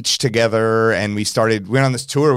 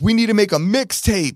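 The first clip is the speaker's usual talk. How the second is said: excited [name]; 9 semitones higher than usual. Retold or imitated. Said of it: "And he's like".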